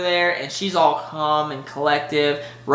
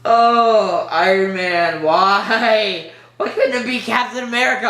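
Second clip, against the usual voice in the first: louder, higher wail of why; mock lament; 4 semitones higher, 4 dB louder, slower than usual. Real, not acted. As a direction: cry out to the heavens, half-joking. Lamenting Iron Man's death.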